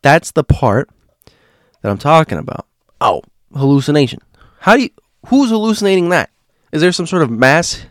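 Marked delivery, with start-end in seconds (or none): 3.0-7.8 high, mocking disbelief; mock the sceptics' claim; sarcastic. Real, not acted.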